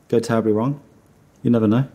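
normal talking voice

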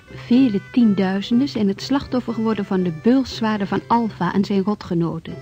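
ordinary talk

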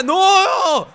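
high, loud disbelieving yell; shocked commentator. Acted. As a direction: scream at the kickout.

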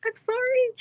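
put-on very high little-kid apology. Streamer answering friends' teasing, little kid voice. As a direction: squeak it like a small child. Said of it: "that little kid voice".

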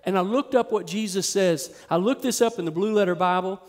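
ordinary delivery

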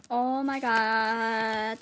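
very slow, long-drawn amazement; stunned disbelief. Real, not acted. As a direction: stretch every word in real awe. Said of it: "this is crazy".

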